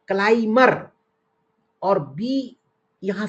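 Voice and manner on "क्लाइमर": क्लाइमर said klai-mer, no b at all; silent b. Shown as a demonstration. Teacher repeating the correct word for pupils, b silent. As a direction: leave out the b in क्लाइमर. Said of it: "ये शब्द है".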